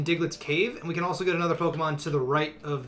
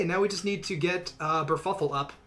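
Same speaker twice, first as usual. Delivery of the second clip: ordinary talk, nothing marked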